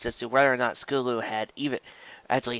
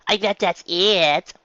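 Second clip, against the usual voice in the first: smug filly; 7 semitones higher, 5 dB louder, much slower than usual. Acted. Bully accusing Dinky.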